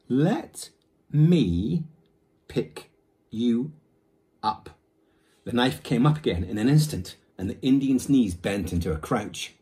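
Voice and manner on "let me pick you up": each word spaced out, higher and louder; very slowly. Acted. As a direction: spell it out word by word. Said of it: "said very slowly".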